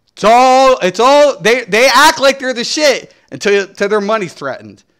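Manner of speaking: loud and high, heated rant; shouting rant. Real, not acted. Ranting about executives, loud and high. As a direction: hammer the point in anger.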